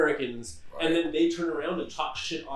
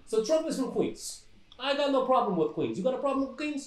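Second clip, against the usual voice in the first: put-on higher-pitched old-school Brooklyn Italian accent; old Brooklyn gangster; 7 semitones higher, 3 dB quieter than usual. Shown as a demonstration. Host showing friends how old Bensonhurst gangsters talk, old Brooklyn accent. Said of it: "You hear the voice I'm using right now".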